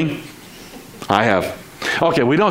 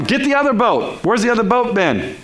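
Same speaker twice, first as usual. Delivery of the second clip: acted yelling out; 8 semitones higher than usual. Fishermen summoning the other boat, high mild yell. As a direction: call out urgently to another boat. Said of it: "they yell out".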